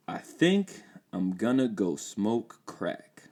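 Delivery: slow, deliberate quote; slow reread. Shown as a demonstration. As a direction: savour the line slowly. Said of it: "That's the last sentence I wrote".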